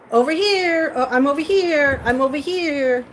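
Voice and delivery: lightly voiced crow calling to its tribe. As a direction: call out to the flock like a crow. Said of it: "the crows actually play Marco Polo".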